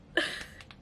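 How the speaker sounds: short unvoiced chuckle; laugh. Real, not acted. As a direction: laugh it off briefly. Laughs after the story's ending.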